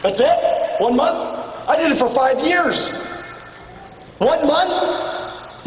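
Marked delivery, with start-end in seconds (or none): 4.2-5.6 higher, stretched disbelieving question; can't believe it's that short; incredulous. Real, not acted. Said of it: "You kidding".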